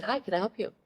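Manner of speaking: high, polite mock receptionist tone; a coach politely greeting a stranger. Coach doesn't recognize her.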